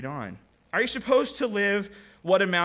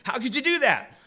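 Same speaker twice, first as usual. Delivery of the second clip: higher, disbelieving what are you doing; incredulous; 7 semitones higher than usual. Retold or imitated. Jeep driver confronting the wolf, higher and incredulous. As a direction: act shocked and accusing at the wolf. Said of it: "gives that incredulous look".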